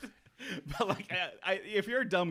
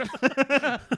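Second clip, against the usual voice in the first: loud high laugh; high burst of laughter; 11 semitones higher, 5 dB louder than usual. Real, not acted. Laughs after own joke.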